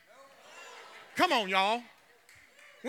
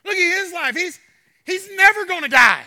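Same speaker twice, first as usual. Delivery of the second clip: a hint of higher, louder complaint; envious Peter; 7 semitones higher, 8 dB louder than usual. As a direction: point at John with jealousy. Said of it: "He said".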